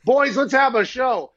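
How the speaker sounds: loud hype call to start the show. Real, not acted. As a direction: show opener shout. Host calling show to start.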